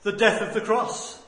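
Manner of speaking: loud, emphatic sermon delivery; full-force preaching. Real, not acted. Preacher telling the church of Christ's suffering, preaching at full force. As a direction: preach it out with punched key words.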